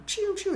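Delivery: high falling "pew" noises; imitated laser shots. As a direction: mimic lasers firing.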